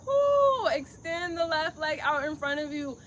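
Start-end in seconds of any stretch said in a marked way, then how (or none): 0.0-0.7 high breathy whew of effort; strained whew. Real, not acted. Yoga teacher straining in balance, high whew. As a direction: blow out the strain of the pose.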